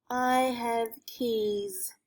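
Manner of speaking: I have keys, spoken very slowly; sounding out words. Shown as a demonstration. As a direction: stretch each word of I have keys. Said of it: "sounded out".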